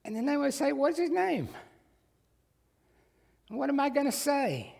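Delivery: worried Moses. Retold or imitated. Moses voiced worrying what to say.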